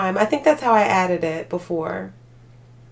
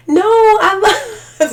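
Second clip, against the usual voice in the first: protesting cry; 12 semitones higher, 10 dB louder, much slower than usual. Real, not acted. Protesting she loves animals.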